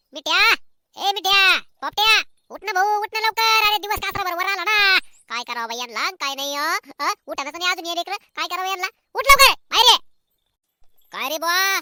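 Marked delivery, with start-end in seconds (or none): 0.1-5.0 loud, high call to wake him. Acted. Mother yelling at her sleeping son to get up. 9.1-10.1 loud, high angry yell; shouting. Acted. Mother yelling at her son to come outside.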